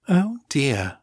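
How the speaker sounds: mock concern in a posh accent; Morgan's posh accent. A narrator's reading. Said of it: "still imitating Morgan's accent and vocal patterns".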